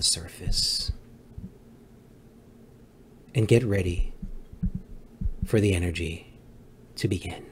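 soft, drifting meditation voice; guided meditation. Pretend. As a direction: guide them gently toward calm. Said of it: "I am your Zen master".

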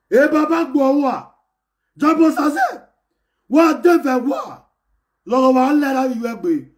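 high, loud yelling at the fan; loud shout. Real, not acted. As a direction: shout him down from the stream.

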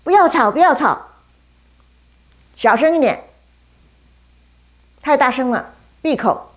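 loud, high shushing rebuke; rude scolding. Acted. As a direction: tell him off rudely. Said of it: "对巴迪买很不客气".

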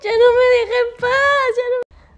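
high, loud complaint; exasperated wail. Real, not acted. Woman complaining her dog won't stop, exasperated wail.

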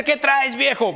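high-pitched mock scold of a quick-tempered wife; imitating a wife challenging her old man, pitched high; feisty wife. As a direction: play the wife who won't back down, snapping at her husband. Daughter copies mother's retort.

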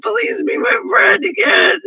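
high, cracking, sobbing plea. Real, not acted. Pleading with her former best friend to come back, sobbing. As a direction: beg through real tears, voice breaking high.

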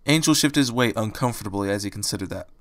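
plain reading voice

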